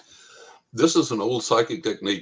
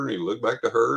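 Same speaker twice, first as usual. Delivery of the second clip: ordinary delivery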